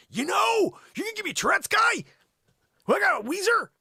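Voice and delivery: high, loud exasperated yelling; hammed-up high indignant shout; mock exasperation. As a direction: throw up your hands on the phone. Exasperated on the phone.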